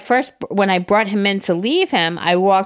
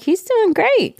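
cheery vet; 12 semitones higher, slower than usual. Retold or imitated. Vet's cheerful report on dog.

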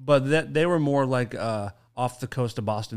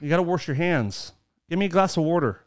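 "wash" as "worsh", "water" as "worder", Pennsylvania style; Pennsylvania accent; 4 semitones higher than usual. Shown as a demonstration. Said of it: "what's the Pennsylvania accent?".

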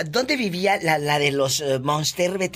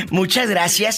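normal talking voice

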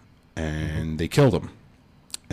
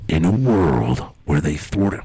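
low, slow trailer narrator; movie trailer voice; 3 semitones lower, slower than usual. Pretend. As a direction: announce it like a film trailer. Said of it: "movie trailers always start off like that".

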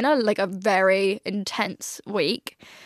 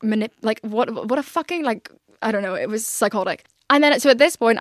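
normal talking voice

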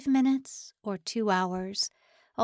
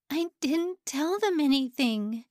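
insistent; 6 semitones higher, 4 dB louder than usual. A narrator's reading. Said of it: "she repeated".

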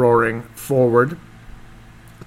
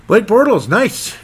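excited praise; 10 semitones higher, 6 dB louder, slower than usual. Real, not acted. Praising the quarterback's four touchdowns.

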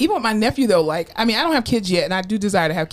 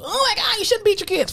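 high, whiny mock voice of critics; mocking people; 13 semitones higher than usual. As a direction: squeal it like a scandalized busybody. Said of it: "'cause people".

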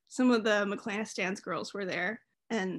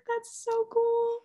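high, drawn-out retold gush; starstruck gushing; 11 semitones higher, much slower than usual. Fan told she deserves the bobblehead, starstruck gushing. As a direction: imitate yourself gushing, slow and high-pitched.

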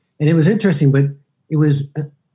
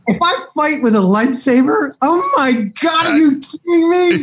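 thrilled shout; 14 semitones higher than usual. Real, not acted. Guest imagines wielding a lightsaber, excited shout.

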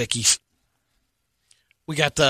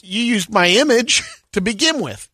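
indignant man; 7 semitones higher than usual. Retold or imitated. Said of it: "the guy's like".

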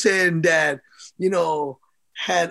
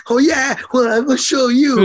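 higher and louder, cocky comeback; defiant; 7 semitones higher, 6 dB louder than usual. Retold or imitated. Young boxer retorting to his disappointed dad, defiant. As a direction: throw the challenge right back. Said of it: "it made me wanna, like".

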